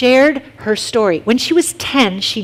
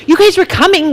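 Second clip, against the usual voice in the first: a higher, louder, indignant "why did you bring me back"; a girl scolding them for reviving her; indignant complaint; 7 semitones higher, 4 dB louder than usual. Retold or imitated. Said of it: "this is her attitude".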